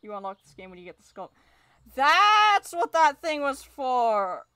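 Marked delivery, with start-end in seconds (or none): 1.9-4.5 long drawn-out, high exclamation; loud realization. Real, not acted. Player learns what the skull key was for, loud realization. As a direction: blurt it out loud, stretching the words. Reacting to a game revelation.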